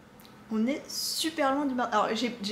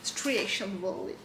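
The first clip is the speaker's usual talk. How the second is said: put-on 1 English accent; 4 semitones lower, slower than usual. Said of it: "mon accent anglais".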